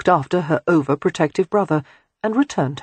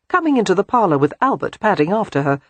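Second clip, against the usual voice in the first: ordinary delivery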